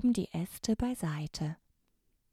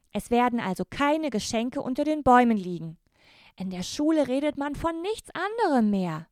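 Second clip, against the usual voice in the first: put-on higher, louder boy's voice, urgent; insistent boy; 5 semitones higher, 5 dB louder than usual. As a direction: press the bad news on his sister. Brother insists on the news.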